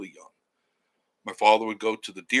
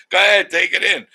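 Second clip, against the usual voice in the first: dad calling out; 9 semitones higher, 12 dB louder than usual. Retold or imitated. Dad voiced urging him to land.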